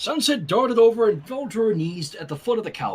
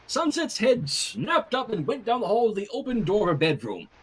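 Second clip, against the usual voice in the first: plain reading voice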